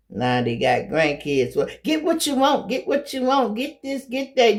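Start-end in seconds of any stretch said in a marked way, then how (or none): 1.8-4.6 much higher, sing-song doting grandparent; indulgent grandma. Retold or imitated. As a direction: gush over the grandkids, pitch way up.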